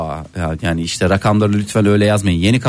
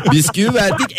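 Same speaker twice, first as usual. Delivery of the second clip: laughing; 9 semitones higher than usual. Real, not acted. Host laughing at bread answer.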